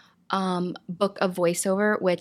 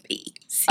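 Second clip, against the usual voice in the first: letters burped out unvoiced. Shown as a demonstration. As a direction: burp each letter of the alphabet. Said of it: "I can burp the alphabet".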